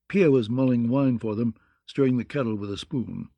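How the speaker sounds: ordinary delivery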